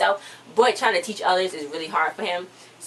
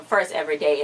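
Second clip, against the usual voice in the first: normal talking voice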